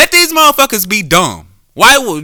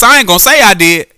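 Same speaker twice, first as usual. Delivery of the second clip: ordinary talk, nothing marked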